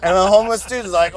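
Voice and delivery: usual speaking voice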